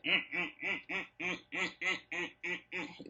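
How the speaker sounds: soft, odd "mm, mm" hummed under a laugh; humming at the table the whole time; imitated humming. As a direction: mimic the old man's strange humming through a chuckle. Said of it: "The client just sitting there like".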